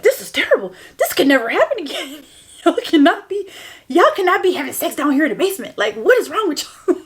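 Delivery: mild mock scolding, laughing through it; mock scolding dad. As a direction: imitate the stern father lightly. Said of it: "he did yell at him".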